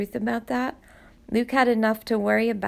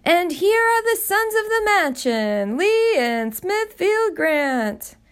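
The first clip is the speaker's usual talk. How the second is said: much higher and louder, grand, names drawn out slowly; booming butler announcement; 10 semitones higher, 4 dB louder, slower than usual. Acted. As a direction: announce the sons like a grand herald. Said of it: "the butler's booming voice called out".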